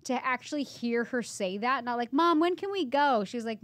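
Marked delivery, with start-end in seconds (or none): 2.1-3.2 put-on higher, louder, slower child whine; lightly imitating a bored child asking to leave; whiny kid. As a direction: nag like an impatient little kid. Child nagging mom to leave.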